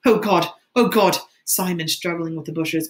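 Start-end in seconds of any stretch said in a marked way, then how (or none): 0.0-1.2 loud cry of despair; loud, anguished exclamation. A narrator's reading. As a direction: cry out in agonized frustration. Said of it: "Ralph cried out".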